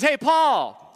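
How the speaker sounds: teacher summoning across the mat; calling out a name. Retold or imitated. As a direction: call the big guy over. Teacher calls a student forward.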